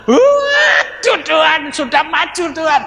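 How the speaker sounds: mock wail of dismay; overwhelmed cry. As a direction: let it burst out in near tears. Overwhelmed at altar call.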